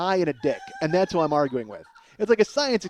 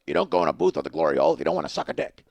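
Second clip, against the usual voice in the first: fast, gruff grouchy lecture; Oscar the Grouch; faster than usual. Acted. Stranger repeating his logic through the wall, grouchy. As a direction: rattle it off like a cranky puppet. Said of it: "He sounds like Oscar the Grouch".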